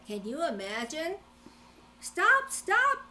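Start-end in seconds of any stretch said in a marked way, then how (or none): 2.0-3.1 high loud cry of stop. A narrator's reading. Old lady shouting after the gingerbread man to stop. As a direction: call out after the runaway cookie. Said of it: "cried the little old lady".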